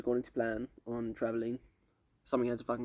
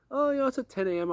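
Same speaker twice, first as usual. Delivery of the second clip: much higher-pitched mock voice of a woman; imitated traveller's reply; 12 semitones higher, 4 dB louder than usual. Stranger answering when her flight is, in an imitated high voice. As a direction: put on a high voice for the quoted stranger.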